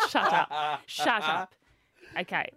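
usual speaking voice

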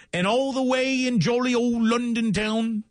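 put-on high, hammy London accent; terrible London accent. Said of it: "It was a terrible London accent".

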